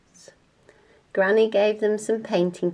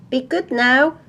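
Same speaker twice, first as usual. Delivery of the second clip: higher, louder parental farewell; called-out parting reminder; 5 semitones higher, 5 dB louder than usual. Acted. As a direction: call it back while leaving.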